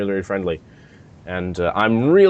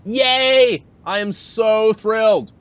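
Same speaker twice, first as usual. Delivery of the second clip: high delighted cheer; gushing with delight; excited; 9 semitones higher, slower than usual. Real, not acted. Friend started watching Sherlock.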